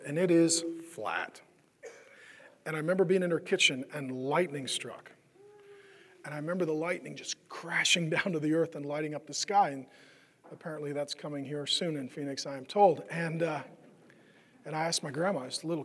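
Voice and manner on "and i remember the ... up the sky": ordinary talk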